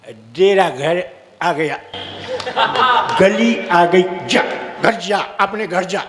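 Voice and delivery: lightly voiced drunk friend, slurred and low; a drunk mate sending his pal home, slow. Drunk friend tells his pal to go home, slow and low. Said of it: "उसका दोस्त बोला".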